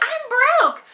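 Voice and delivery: hammed-up imitation of a pouting girl; mock whiny Rory. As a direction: imitate her complaining, exaggerated. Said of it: "and she's like".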